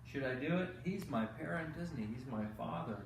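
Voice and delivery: plain reading voice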